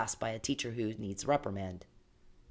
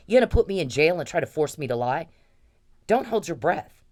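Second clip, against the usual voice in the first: defiant anger; 4 semitones higher, 7 dB louder than usual. Real, not acted. Defiant rant against being jailed.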